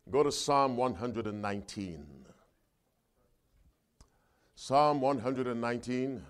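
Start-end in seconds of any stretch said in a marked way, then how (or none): none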